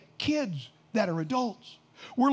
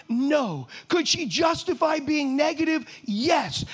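ordinary talk

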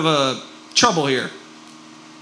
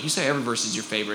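fast quoted complaint; 3 semitones lower, 7 dB quieter, much faster than usual. Retold or imitated. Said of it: "they're like".

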